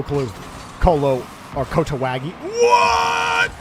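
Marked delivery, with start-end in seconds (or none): 2.3-3.5 very high, drawn-out squeal; real incredulous cry; shocked disbelief. Real, not acted. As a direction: stretch it out at the top of the voice. Disbelief at the play.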